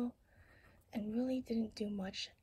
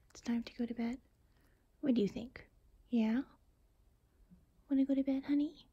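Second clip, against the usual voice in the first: louder sing-song questions to a pet; pet talk; 3 semitones higher, 7 dB louder than usual. Real, not acted. Owner asks her honey about going to bed, cooing pet talk. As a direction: coax a little pet toward bed.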